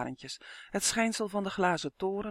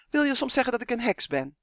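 higher, indignant question; offended challenge; 7 semitones higher, 4 dB louder, faster than usual. A narrator's reading. Olivia bristling at Mare's remark, offended. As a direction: challenge it as a personal insult.

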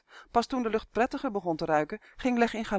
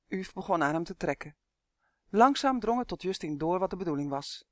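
ordinary delivery

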